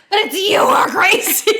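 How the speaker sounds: high, loud mock outburst; a shrill imitation of an angry kid; child accusing the class; indignant kid. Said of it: "He's like".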